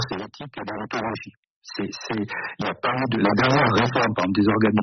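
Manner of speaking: crackly metallic phone voice; like a breaking-up phone call; bad phone line. Real, not acted. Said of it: "ça grésille et vous avez une voix métallique".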